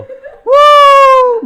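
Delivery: sustained high, loud hoot; eerie bird call. Retold or imitated. Teller imitating night sounds on the trail, high hooting. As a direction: howl out a long bird-like "woo". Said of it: "이런 소리 들리고".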